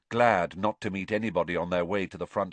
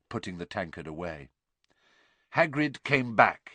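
usual speaking voice

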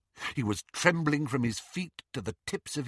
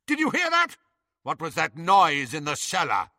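alarmed shouting; 5 semitones higher, 3 dB louder than usual. Acted. Lucius shouting from above.

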